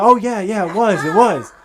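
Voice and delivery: nervous overeager agreement; eager bluff. Retold or imitated. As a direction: agree way too fast to save the lie.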